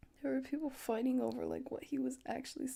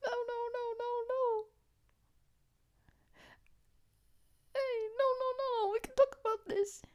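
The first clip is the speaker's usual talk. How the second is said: high, fast pleading; mock panic; 10 semitones higher, faster than usual. Pleading with Connor not to rob him, panicked and high. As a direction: beg them to stop, way up high. Pleading against being robbed.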